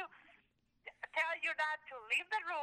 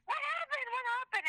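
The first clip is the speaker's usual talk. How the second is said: a high, urgent imitation of the dad rushing in; alarmed dad; 10 semitones higher than usual. Dad rushing to his fallen daughter, imitated alarm. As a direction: mimic a panicked father calling out. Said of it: "your dad is like".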